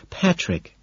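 a sharp little warning call of his name. A narrator's reading. Evelyn warning Patrick over the cigar, mild warning. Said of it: "she warns".

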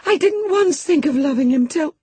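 put-on a crying girl trying to explain; tearful girl. A narrator's reading. Cathy pleads, cut off.

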